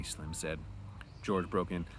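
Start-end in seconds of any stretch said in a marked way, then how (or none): none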